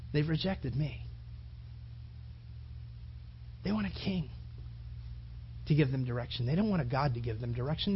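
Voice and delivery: low, soft consoling voice; hushed divine reply. Acted. God answers the weeping prophet Samuel, hushed and low.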